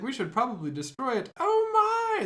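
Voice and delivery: high, loud startled squeal; mock alarmed shriek. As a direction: shriek it out in panic. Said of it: "She's like".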